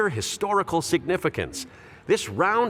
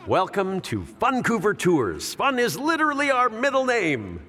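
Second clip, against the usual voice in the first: tour guide spiel; 7 semitones higher than usual. Acted. Guide restarting his welcome to tourists, hammed-up.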